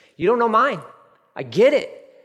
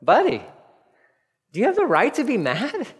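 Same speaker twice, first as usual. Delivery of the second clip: higher, pleading voice of God questioning Jonah; voicing God; 6 semitones higher than usual. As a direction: voice God gently pressing Jonah, buddy. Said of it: "God's like".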